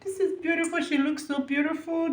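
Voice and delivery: barely holding it together watching the show; overcome with emotion. Real, not acted. Vlogger moved watching the Eurovision performance, hushed and emotional. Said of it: "I just have these emotions all over me".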